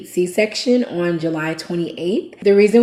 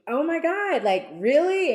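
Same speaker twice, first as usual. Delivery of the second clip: high-pitched imitation of disbelief; retold shock; 10 semitones higher than usual. Wife retelling her reaction to two lines, shocked. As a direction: relive the stunned question, voice jumping up.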